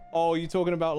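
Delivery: usual speaking voice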